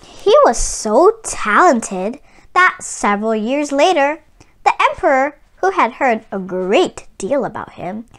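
calm storybook reading, evenly paced; story narration. A narrator's reading. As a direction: read it like a picture book to children. Reads the story aloud.

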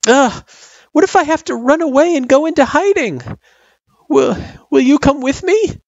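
put-on very high, loud, anxious kid voice; worried child. A narrator's reading. Girl asking her friend to run away with her, anxious. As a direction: fret aloud as a worried child.

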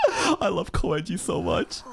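ordinary delivery

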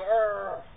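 long drawn-out "arr"; playful growl while rubbing the dog; demonstrated effort noise. Shown as a demonstration. Trainer rubbing dog up real good, playful growl. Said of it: "You go".

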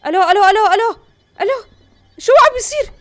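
high, loud, rapid-fire panic; alarmed calling out. Real, not acted. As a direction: call out urgently in alarm. Something happens suddenly in game.

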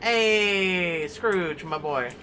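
lightly voiced old miser greeting; a hint of Scrooge. Gamer reading Scrooge greeting the player.

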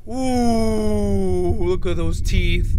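sharp gasp then a loud, awed ooh; gasp of surprise. Real, not acted. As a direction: gasp like the teeth just jumped at you. Reacting to monster teeth on screen.

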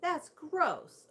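a high, disgusted whine; complaining. A narrator's reading. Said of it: "a voice complained".

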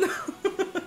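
a high, breathy burst of laughter; laughing too hard to voice it. Real, not acted. After losing Steven's balloon, breathy laughter.

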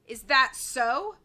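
slow, loud indignant challenge; angry sputter. A narrator's reading. Boss reacts to henchman's slight, angrily. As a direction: challenge him angrily, stretching it out. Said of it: "Diamond sputtered angrily".

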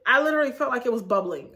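usual speaking voice

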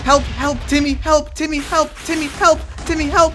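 real panicked calls for Timmy; shouting for help. Real, not acted. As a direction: call out desperately to a friend. Calling friend for help, dying.

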